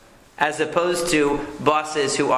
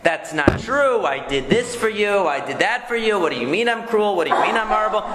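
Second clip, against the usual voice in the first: defensive protest; 6 semitones higher than usual. Shown as a demonstration. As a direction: protest hurt and indignant. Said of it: "What am I gonna say".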